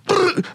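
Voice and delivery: gun rattle. Retold or imitated. Soldier menacing the crowd with the gun, imitated brrr. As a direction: end with a quick burst sound. Gun noise after shouting.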